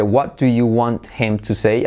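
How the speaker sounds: careful textbook question; separate words. Shown as a demonstration. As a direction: pronounce "want him" as two full words. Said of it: "Fijaos la diferencia entre".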